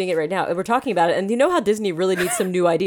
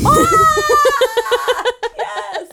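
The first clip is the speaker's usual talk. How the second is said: excited shriek; 14 semitones higher, 4 dB louder than usual. Real, not acted. Thrilled by trick or feet pun.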